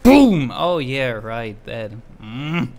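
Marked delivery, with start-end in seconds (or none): 0.0-0.5 loud high boom; explosion imitation. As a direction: shout the boom like a blast.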